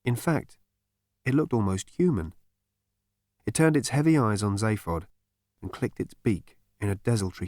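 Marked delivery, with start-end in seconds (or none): none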